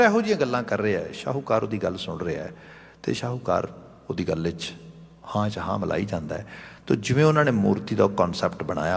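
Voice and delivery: usual speaking voice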